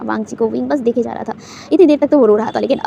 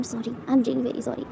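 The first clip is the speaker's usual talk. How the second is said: tender apology; 7 dB quieter than usual. A narrator's reading. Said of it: "हग करते हुए कहता है".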